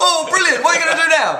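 high, loud eager onlooker; high-pitched comic enthusiasm; mock excitement. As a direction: gush with hammed-up delight. Acted onlookers greeting risen Jesus.

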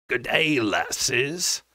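a lightly voiced fat patron, broad and condescending; deep, patronizing. A narrator's reading. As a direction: talk down to them with mild smug width. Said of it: "his voice deep and wide as it was patronizing".

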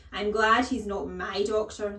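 loud, cutting retort; stern, belittling headmistress; scornful headmistress. A narrator's reading. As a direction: sneer it loudly at the boy. Headmistress sneers at Nigel.

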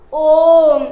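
"ओम" drawn out over three beats, loud; chanted "ओम" stretched to three beats; prolonged om. Shown as a demonstration. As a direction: hold the "ओम" for three counts and sound it out strong. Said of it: "इसका उच्चारण काल किस प्रकार होगा?".

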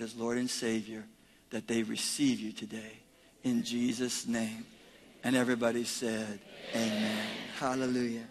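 low, soft, steady prayer; public prayer. Real, not acted. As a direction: pray quietly on behalf of the congregation. Leads congregation in prayer.

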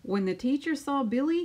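plain reading voice

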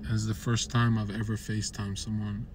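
ordinary talk

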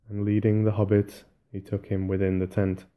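usual speaking voice

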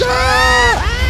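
loud high scream of pain. Acted. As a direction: shriek as the machine hits. Painful transformation in the chamber.

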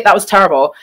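ordinary delivery

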